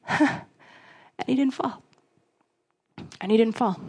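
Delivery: soft, dropped voice, defeated; quiet letdown. Retold or imitated. A believer alone after the rally, soft defeated. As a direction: murmur it as hope drains away. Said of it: "you're like".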